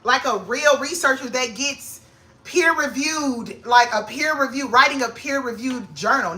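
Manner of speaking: high, thrilled daydream; picture your future, thrilled; excited dreaming. Retold or imitated. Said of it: "I'm like".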